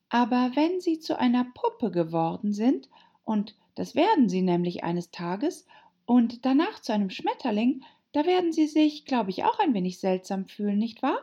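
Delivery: put-on high-pitched girl character; Alice, earnest and high; polite Alice. A narrator's reading.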